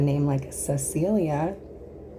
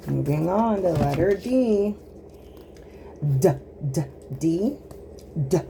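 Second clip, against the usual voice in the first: ordinary talk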